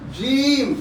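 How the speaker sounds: "जीम" with the ee drawn out twice as long; long vowel. Shown as a demonstration. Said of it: "बड़ी जीम अंतरा है, दो ह्रस्व के बराबर होगा". ("जीम" लंबी ई के साथ खींचकर)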